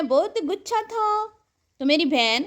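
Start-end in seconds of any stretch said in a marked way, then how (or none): 0.0-1.3 slow and higher, stretching تھا; slow teasing repeat of گچھا; drawn-out taunt. Real, not acted. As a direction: drag out "بہت گچھا تھا" mockingly.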